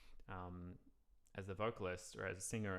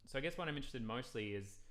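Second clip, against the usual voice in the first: normal talking voice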